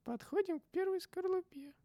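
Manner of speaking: squeaky high announcement; high-pitched character voice. Acted. Very high put-on voice.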